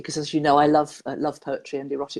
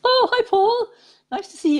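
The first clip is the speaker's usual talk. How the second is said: bright, much higher delighted hello; excited greeting; 6 dB louder than usual. Real, not acted. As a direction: greet him like a welcome surprise. Greeting a caller warmly.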